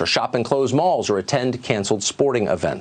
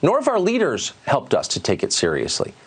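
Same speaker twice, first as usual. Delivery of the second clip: ordinary delivery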